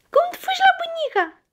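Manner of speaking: very high, quick coaxing question. Real, not acted. Parent asking a child how they run to grandma, high playful coaxing.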